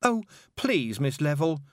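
high pleading wail. A narrator's reading. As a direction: beg in a rising wail. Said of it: "Tiffany wailed".

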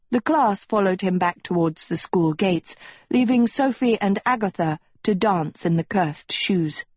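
usual speaking voice

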